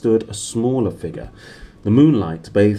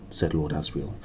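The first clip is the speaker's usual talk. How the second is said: plain reading voice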